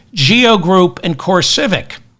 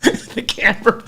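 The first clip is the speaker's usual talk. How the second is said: laughing. Real, not acted. Cracking up at own joke.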